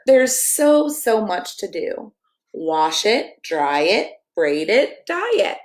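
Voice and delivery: lively picture-book read-aloud, sing-song rhymes; reading to children. Acted. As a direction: read it like a bedtime story for kids.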